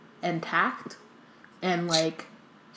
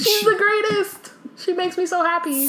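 gushing shriek; 12 semitones higher than usual. Real, not acted. Hosts gushing over Hermione, high squealing delight. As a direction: squeal it like a superfan.

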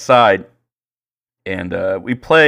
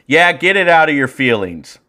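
raised, higher voice imitating a heckling coach; retold jeering shout; 6 semitones higher, 5 dB louder than usual. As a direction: heckle the umpire, mock shouting. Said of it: "is what he shouts".

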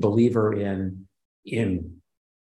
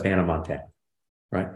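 ordinary talk

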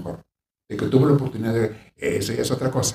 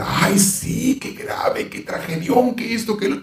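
much higher, theatrical gasping pity; mock pity; 10 semitones higher, slower than usual. Mocking a counselor who dramatizes a client's pain, high exaggerated pity. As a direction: parody an overdramatic counselor. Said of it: "Yo no hago eso".

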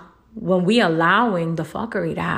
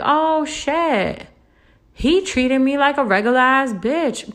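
high mocking whine, imitating a hurt friend; lightly hammed-up complaining woman; mock whiny girl; 7 semitones higher, slower than usual.

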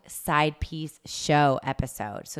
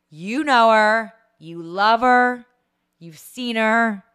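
hyped guest intro; 3 semitones higher, 8 dB louder than usual. Acted. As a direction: announce her like a star taking the stage. Host hyping guest's entrance.

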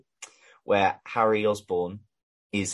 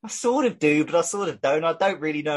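squeaky high, unsure and torn, half-joking; dithering fan voice; 8 semitones higher than usual. Retold or imitated. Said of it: "And the Chelsea fans, like".

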